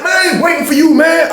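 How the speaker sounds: high, loud brush-off; high loud yell waving a friend off; dismissive yell. Retold or imitated. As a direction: shout him off, not waiting for anyone.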